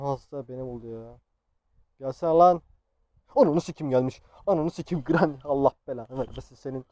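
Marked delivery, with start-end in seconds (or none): 2.0-6.9 loud, heated cursing at an enemy; angry shouting. Real, not acted. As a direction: yell abuse as she attacks. Granny attacks, player curses her. (öfkeyle bağırarak küfür)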